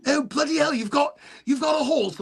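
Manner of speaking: high, excited "bloody hell" in a British voice; amazed British cop. Retold or imitated. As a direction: act thrilled that the Texan owns a horse. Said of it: "He goes".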